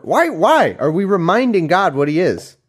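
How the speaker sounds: loud, high-pitched incredulous rant; exasperated outburst. Real, not acted. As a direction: cry out in sheer disbelief. Breaks off reading to rant.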